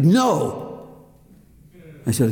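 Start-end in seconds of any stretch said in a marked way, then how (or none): 0.0-0.9 high, emphatic "no"; angry denial. Retold or imitated. Friend denying he is angry at God, indignant. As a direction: snap back a denial, pitched up. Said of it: "Are you angry at God?".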